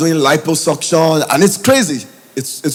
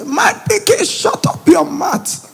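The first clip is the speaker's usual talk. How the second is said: scolding mama voice; 14 semitones higher than usual. Acted. Mother shouting at her child to shut up about the rain. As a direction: play the mother telling her child to hush.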